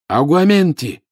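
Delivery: loud, high spell cast; shouted spell. A narrator's reading. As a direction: shout the incantation. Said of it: "крикнул он". (выкрикнутое заклинание)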